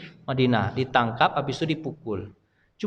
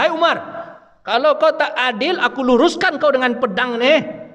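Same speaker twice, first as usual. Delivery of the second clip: a defiant youth warning the caliph, raised voice; threatening challenge; 7 semitones higher than usual. Retold or imitated. Young man threatens Umar.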